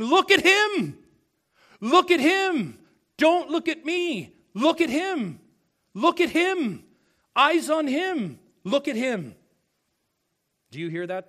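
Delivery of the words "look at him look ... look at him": high, loud ecstatic shout of praise; a crowd of saints shouting to point everyone at the Lamb; crying out with a loud voice. Acted. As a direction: cry it out as the whole throng around the throne. Acting the heavenly throng crying out.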